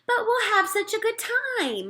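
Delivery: lightly voiced mother, high and slow; mother's voice. A narrator's reading. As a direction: coax him like a cheerful mom. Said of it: "my mother said".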